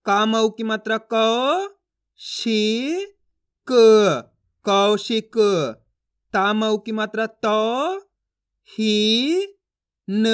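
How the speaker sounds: slow chant with long gliding au; syllable reading drill. Shown as a demonstration. Teacher modelling word reading for learners, slow and drawn out. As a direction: sound out each letter apart, held long. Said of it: "इन अक्षरों का उच्चारण कर रहा हूँ".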